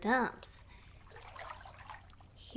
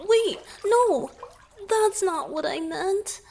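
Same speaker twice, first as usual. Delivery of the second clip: flustered outburst; 9 semitones higher, 8 dB louder than usual. Acted. Merfolk hastily corrects the listener's misunderstanding, flustered. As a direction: jolt up high and loud, correcting fast.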